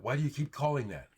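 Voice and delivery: ordinary talk, nothing marked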